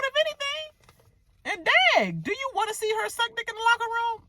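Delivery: high-pitched calling-out; high, exasperated ribbing; mock incredulous. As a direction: challenge her in a high, disbelieving voice. Calling out a cast member directly.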